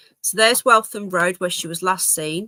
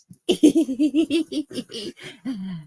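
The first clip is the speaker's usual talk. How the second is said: laugh; 5 semitones higher than usual. Real, not acted. Laughing at dog.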